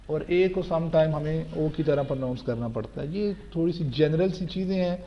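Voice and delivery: normal talking voice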